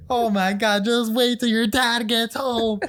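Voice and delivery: high, loud, slow warning from mom; threatening mom. Retold or imitated. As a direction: scold like a mother promising dad's punishment. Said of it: "my mom just comes".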